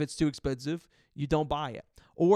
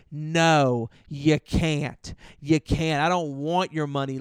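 firm refusal; 5 dB louder, much slower than usual. Retold or imitated.

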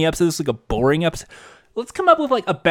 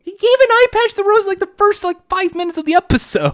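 incredulous; 3 dB louder than usual. Real, not acted. Incredulous complaint.

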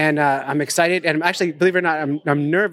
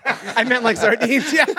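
high, slow words through laughter; helpless giggly correction; laughing; 9 semitones higher than usual. Real, not acted. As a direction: crack up while clarifying. Laughing at worms misunderstanding.

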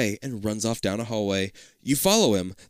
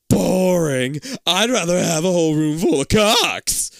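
loud, raised mock complaint; hammed-up disgust; 4 semitones higher, 7 dB louder, slower than usual. Bored kid in a room full of clocks, loud mock complaint.

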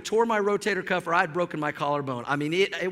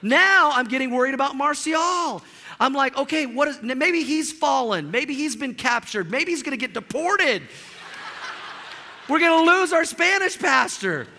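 mounting worry; 6 semitones higher, 3 dB louder than usual. Retold or imitated. Rising panic about missing friend.

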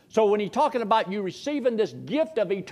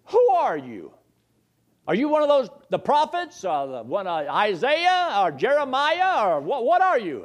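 higher, louder mock interrogation; insistent questioning; 6 semitones higher, 4 dB louder than usual. People questioning John the Baptist about who he is, insistent. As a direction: press him with raised-pitch questions.

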